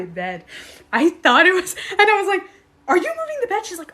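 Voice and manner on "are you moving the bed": much higher, shocked accusation; startled question. Retold or imitated. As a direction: demand an answer in alarm. Confronting daughter, startled.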